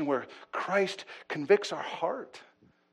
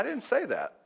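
a laugh running through the words; 7 semitones higher than usual. Real, not acted. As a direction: chuckle while denying it. Joking about the sermon topic.

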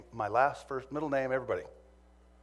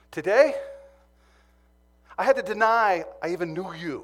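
louder and higher, half laughing; blurting out the embarrassing truth; loud confession; 4 semitones higher, 9 dB louder than usual. Retold or imitated. Brother confronting him.

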